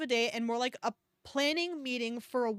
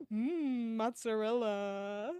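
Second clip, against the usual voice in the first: ordinary talk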